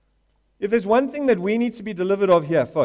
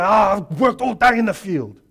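a hint of a sulky older brother whining; lightly voiced resentful brother; mock complaint; 3 semitones higher, 5 dB louder than usual. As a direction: grumble like a sulky older son. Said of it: "you stand and go".

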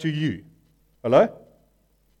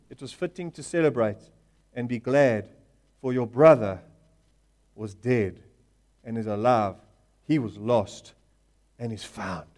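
tender father fading; 7 semitones lower than usual. Acted. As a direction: let it fade out gently. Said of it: "father's closing words, fading".

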